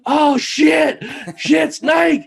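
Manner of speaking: high, loud yelp of alarm; panicked shout. Retold or imitated. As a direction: shout it high as if a snake just appeared. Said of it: "all you hear in perfectly good English is".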